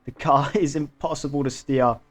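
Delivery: dismayed excitement. Real, not acted. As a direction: exclaim in real dismay as your race falls apart. Driver crashes own car, engine gone.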